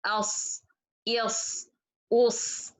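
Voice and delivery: "as, is, us" each ending in a held heavy s. Shown as a demonstration. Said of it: "watch yourself when you say letter صاد".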